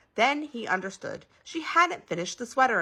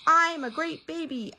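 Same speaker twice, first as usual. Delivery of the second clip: high, slow proud announcement; proud boast; 10 semitones higher, 4 dB louder than usual. A narrator's reading. Baby telling everybody, high and slow.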